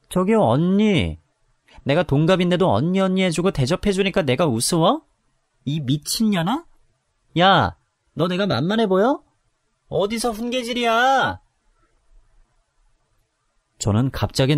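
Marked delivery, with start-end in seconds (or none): 0.1-11.4 put-on drunken rage. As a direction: curse her out, slurring. Drunk sister-in-law cursing her. (술 취해 욕하는 목소리)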